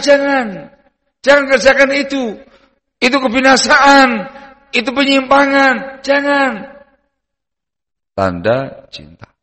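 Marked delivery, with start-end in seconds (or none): 0.0-2.4 alarmed plea. Acted. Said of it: "akan membuat kamu bereaksi". 3.0-6.5 urgent plea. Acted. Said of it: "akan membuat kamu bereaksi".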